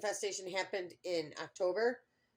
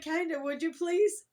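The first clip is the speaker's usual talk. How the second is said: high, louder pleading question; retold sheepish plea; 10 semitones higher, 6 dB louder than usual. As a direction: ask a favour in a high, wheedling mock plea.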